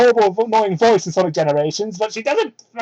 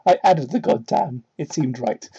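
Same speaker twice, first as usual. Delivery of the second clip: usual speaking voice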